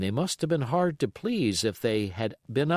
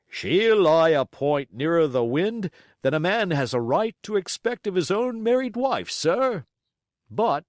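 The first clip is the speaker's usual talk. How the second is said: higher, louder blunt sea captain; captain's gruff praise; 8 semitones higher, 6 dB louder than usual. Acted. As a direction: praise the ship like a proud old salt. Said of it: "he would add".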